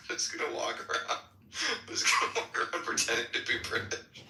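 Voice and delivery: normal talking voice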